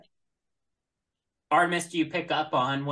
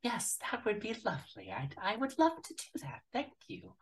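demure lady voice; 8 semitones higher, 7 dB quieter than usual. Acted. Lady character answers in role.

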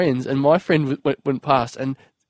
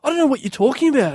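higher, quick, mildly huffy; retold stroppy denial; 8 semitones higher, faster than usual. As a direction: imitate someone getting defensive. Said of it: "started getting really stroppy with me".

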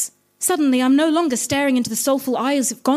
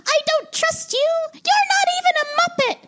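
put-on very high, childlike frog voice; annoyingly high but adorable little nephew voice; high-pitched Robin. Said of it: "speak in his annoyingly high-pitched but adorable voice".

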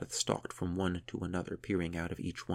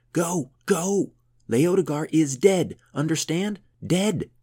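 loud, high urgent yelling; shouting; 10 semitones higher, 12 dB louder, slower than usual. A narrator's reading. Wilfrith yelling at the guard to run. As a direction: drive the guard off with the news. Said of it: "Wilfrith shouted again".

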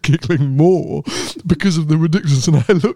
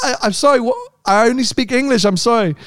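flustered apology; 7 semitones higher than usual. Retold or imitated. Acts own flustered apology to woman.

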